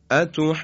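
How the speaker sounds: اتحجونی called out with the madd; tajweed example word. Shown as a demonstration. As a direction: say اتحجونی with the long vowel held. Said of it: "به مثال بعدی توجه بفرمایید".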